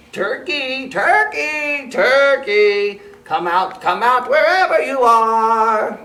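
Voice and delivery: high, loud sing-song call. Acted. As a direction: call the turkey out of hiding. Farmer calling for turkey.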